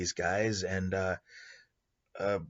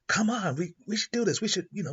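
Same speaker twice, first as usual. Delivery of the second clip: pitched up, pleading and keen; eager urging; 11 semitones higher than usual. Retold or imitated. Clown urging his boyfriend to stop the robbery, high eager pleading. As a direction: push hard, get him on board. Clown urges guard to act.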